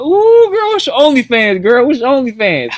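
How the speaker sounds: hitting on a girl as a pretend old man, high and sleazy. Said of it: "he would pretend to be an old man".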